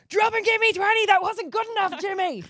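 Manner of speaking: high, barking mock drill sergeant; drill sergeant dad. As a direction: bark orders like a tough sports dad. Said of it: "he'll be like".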